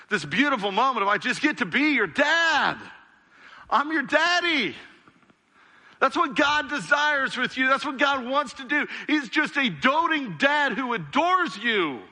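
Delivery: high, ringing preacher's exultation; impassioned preaching. Real, not acted. Preacher likening God to a dad adoring his child, impassioned preaching. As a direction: soar with a doting father's delight. Preacher builds to father's delight.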